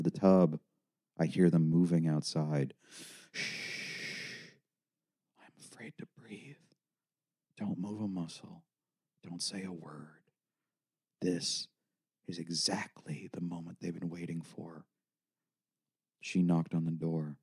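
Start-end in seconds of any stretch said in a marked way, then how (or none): 3.3-14.8 whispered, barely-breathed words with long pauses; hushed, halting, hiding from someone; fearful hush. Acted. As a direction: whisper as if afraid of being overheard. Hiding silently in the bathroom.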